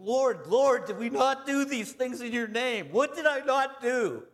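high, loud, desperate cry of the damned; frantic begging. Retold or imitated. Preacher voicing the damned begging the Lord, frantic high cry. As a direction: plead for your life, voice cracking high. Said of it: "Begging, begging".

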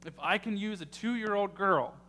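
rising preacher's build to the congregation; preaching. Acted. Pastor urging his congregation, building preaching. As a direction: drive the point home like a sermon.

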